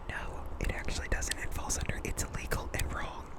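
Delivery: hushed, voiceless correction slipped in; barely audible whispered objection; whispered aside. Real, not acted. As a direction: whisper it under your breath.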